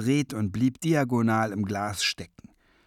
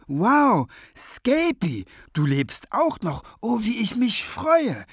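high, loud gushing greeting; thrilled, raised-pitch reunion; delighted surprise; 11 semitones higher, 5 dB louder, slower than usual. A narrator's reading. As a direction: exclaim with childlike glee. Clarabelle delighted to find Scapegrace.

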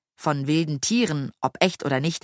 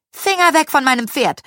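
high, loud warning; sharp, raised-pitch order; angry shout; 10 semitones higher, 6 dB louder than usual. A narrator's reading. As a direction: shout him away from your horse. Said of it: "rief Carolina".

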